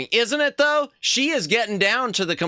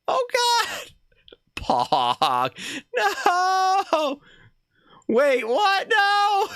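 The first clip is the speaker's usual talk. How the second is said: much higher, cried-out alarm; shocked cry; much slower than usual. Real, not acted. Streamer shocked by what appears on screen. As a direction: react in real shock.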